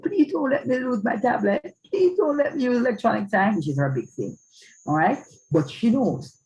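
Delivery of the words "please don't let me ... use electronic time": put-on high-pitched begging child voice; pleading child. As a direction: plead like a worried kid. Child begs to keep tablet.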